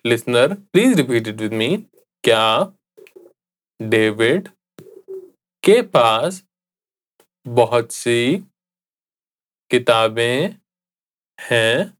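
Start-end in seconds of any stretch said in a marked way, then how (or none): 2.2-11.9 broken into small chunks with long pauses; slow, separated language-lesson delivery; slow repetition. Shown as a demonstration. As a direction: leave room for the learner to echo each word. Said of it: "I'll say it again slowly".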